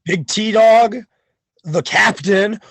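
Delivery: normal talking voice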